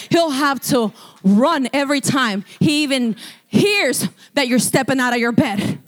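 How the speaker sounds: ordinary talk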